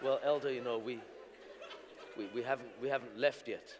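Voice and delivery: low, soft sheepish answer; embarrassed mumble. Retold or imitated. Pastor admitting to the elder he never left, sheepish. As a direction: mumble it out, ashamed. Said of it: "to phone the elder".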